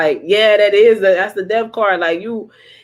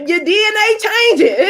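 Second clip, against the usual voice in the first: laughing shout; 13 semitones higher, 3 dB louder than usual. Real, not acted. Excited outburst about DNA.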